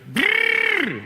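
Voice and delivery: high, strangled growl; imitated growl. Woman on the subway growling, high imitated growl. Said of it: "she goes".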